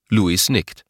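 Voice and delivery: plain reading voice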